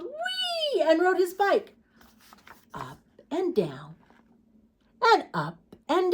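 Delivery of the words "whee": high drawn whee; gleeful whee. Acted. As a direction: let it fly with joy. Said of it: "He yelled".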